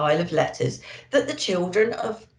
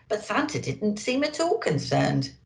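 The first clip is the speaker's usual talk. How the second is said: plain reading voice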